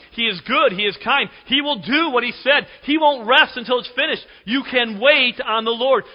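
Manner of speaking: loud, high, rising sermon voice; fervent preaching. Acted. Pastor urging the congregation to trust God, preaching loudly. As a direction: preach it out with conviction.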